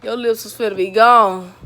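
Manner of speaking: loud teasing yell; shouting. Real, not acted. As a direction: holler it across the room. Shouting at cousin after prank.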